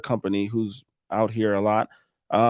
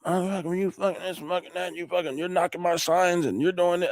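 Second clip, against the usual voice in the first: mock angry caller; 7 semitones higher, 3 dB quieter than usual. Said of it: "pissed, cussing at me".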